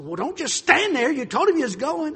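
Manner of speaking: loud, high scolding mom; acted exasperated telling-off; retold angry yell. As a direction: imitate a mother yelling at her child. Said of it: "yelled at her".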